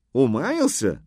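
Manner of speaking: high, lively cry of mock disbelief; merry shout. A narrator's reading. Horseman teasing the old man, merrily shouting. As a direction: toss it back loudly and cheerfully. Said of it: "весело крикнул Йонах".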